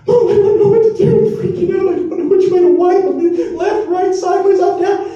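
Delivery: a brother shrieking to mom for help; panicking new dad. Retold or imitated. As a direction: squeal it like a helpless dad freaking out. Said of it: "and he called my mom".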